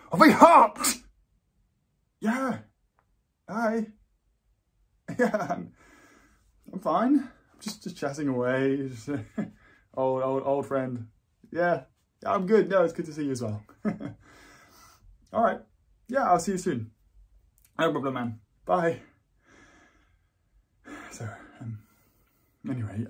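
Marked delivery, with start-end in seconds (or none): 0.1-0.8 loud, high-pitched exclamation of horror; outraged shout. Acted. Same reveal repeated as outraged outburst. 2.2-19.0 louder, awkwardly cheery small talk; overbright greeting. Acted. Breaks off to greet passing acquaintance.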